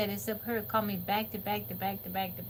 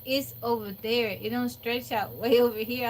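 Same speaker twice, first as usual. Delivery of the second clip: ordinary talk, nothing marked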